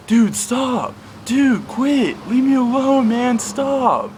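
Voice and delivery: high, loud pleading yell; panicked shouting. Retold or imitated. Tripping and scared, shouting at laughing friends to stop. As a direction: scream at them to leave you alone.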